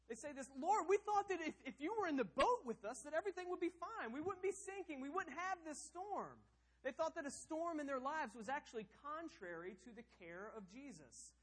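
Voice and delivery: preaching at full force; fervent sermon peak; high, loud impassioned preaching. Acted. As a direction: cry it out from the pulpit. Impassioned preaching of disciples' plea.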